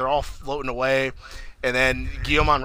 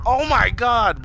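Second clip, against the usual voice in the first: high, louder retold gasp of awe; pitched-up mock astonishment; amazed exclamation; 10 semitones higher, 5 dB louder, much slower than usual. Retells own amazed reaction.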